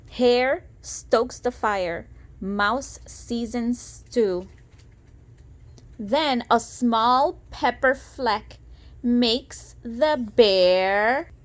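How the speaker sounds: lilting storybook read-aloud; sing-song rhyming narration; reading to children. A narrator's reading. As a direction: read it like a bedtime picture book. Reading picture book aloud.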